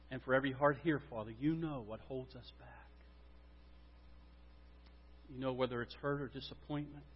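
low, hushed pastoral prayer with long pauses; solemn prayer. Real, not acted. Pastor leads congregation in asking for revival, hushed prayer.

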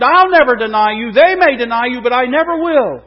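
loud and high, sure of himself; bold vow. Acted. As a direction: boast it like a man who can't fail. Peter's boast to Jesus.

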